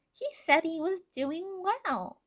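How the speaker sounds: high, loud, drawn-out delight; excited. A narrator's reading. Tiki telling Plagg he was doing well, excitedly. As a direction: share good news in a high little voice.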